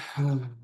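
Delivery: long releasing exhale sigh; demonstrated sigh. Shown as a demonstration. Host showing the group how to exhale, demonstrated sigh. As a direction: let the breath fall out in an audible sigh. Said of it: "Exhale with a sigh".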